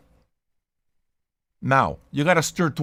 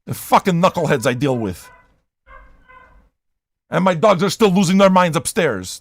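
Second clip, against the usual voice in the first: usual speaking voice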